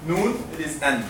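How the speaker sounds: ordinary talk, nothing marked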